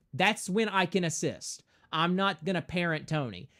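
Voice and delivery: ordinary delivery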